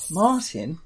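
put-on New York accent calling Martin. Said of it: "she says in a New York accent".

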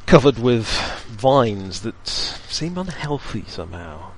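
slow, hushed scene-setting narration; game master scene setting. A narrator's reading. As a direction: paint the gloomy crypt slowly. GM describes the crypt scene.

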